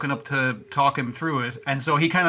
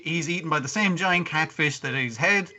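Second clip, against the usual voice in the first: normal talking voice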